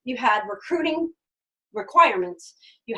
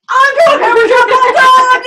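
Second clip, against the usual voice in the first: much higher, loud triumphant shout; excited shout; 13 semitones higher, 17 dB louder, slower than usual. Retold or imitated. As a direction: announce it to the whole house. Runs home announcing decision.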